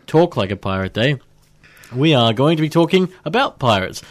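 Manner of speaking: usual speaking voice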